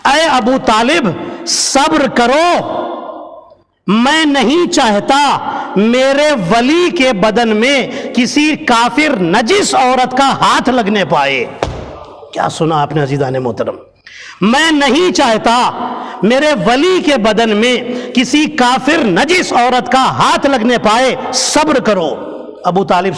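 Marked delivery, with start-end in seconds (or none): 0.0-11.5 slow, higher, solemn voice from above; echoing divine voice. Acted. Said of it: "ایک آواز گھر میں گونجی". 14.4-22.1 divine voice. Acted. Repeats the divine voice.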